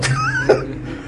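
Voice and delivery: brief real laugh at the punchline; a quick amused chuckle; light laugh mid-sermon; short laugh. Real, not acted. Laugh after story punchline.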